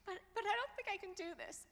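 high, loud, fast tearful protest; hurried high wail of despair; imitating tearful daughter. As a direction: rush it out like a crying girl who can't cope. Daughter sobbing, overwhelmed.

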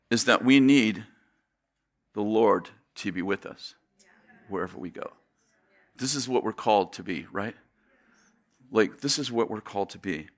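low, soft, sincere appeal; earnest, hushed. Real, not acted. Preacher telling the congregation what they're called to be, quietly earnest. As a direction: drop low and quiet to land the point.

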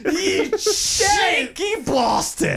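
very slow, high, laughing "cheeky bastard"; giggling fake British accent; laughing mock cockney. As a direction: ham up a Londoner's taunt.

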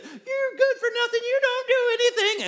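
very high, loud mock-wife squawk; hammed-up scolding in falsetto; nagging wife's tone. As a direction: exaggerate an angry wife in a high, put-on voice. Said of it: "didn't say any of those things or use that tone".

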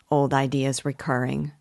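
usual speaking voice

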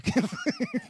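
high squealing laugh at his own slip; excited laugh. Real, not acted. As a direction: burst into a high-pitched giggle. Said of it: "too excited to say that".

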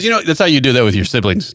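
usual speaking voice

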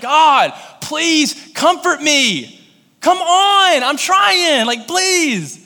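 high, loud, stretched begging cry; pleading prayer. Retold or imitated. Preacher reliving a desperate prayer, high pleading cry. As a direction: beg for comfort at the top of your voice.

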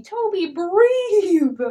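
loud, high, drawn-out shout; urgent call. Retold or imitated. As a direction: call out to get him breathing again. DM calls to laughing player.

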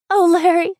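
put-on high, loud girlish plea; contrite little girl. A narrator's reading. Sister caught by her brother, contrite. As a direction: apologize in a high child's voice. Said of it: "she stammered contritely".

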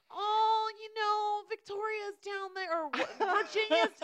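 high, slow sheepish excuse; lightly voiced embarrassed husband; sheepish Patrick. Husband excusing the party to his wife, high sheepish mock voice. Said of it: "Pat's like".

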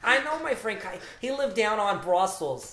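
loud, high, hammed-up New Jersey accent. Guest from Jersey chatting about Kyrie, hammed-up Jersey accent. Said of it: "I'm from Jersey".

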